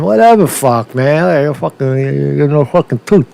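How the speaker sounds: ordinary delivery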